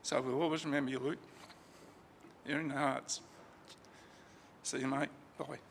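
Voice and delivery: fighting back tears, voice catching; a real farewell choking up at the end; voice breaking with grief. Real, not acted.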